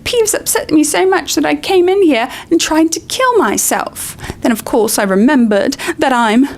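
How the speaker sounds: high, theatrical tragedy; milk it like a tragic heroine; dramatic. Acted. Myrtle tells Harry her tragedy, theatrical. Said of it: "said Myrtle dramatically".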